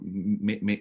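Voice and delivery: ordinary talk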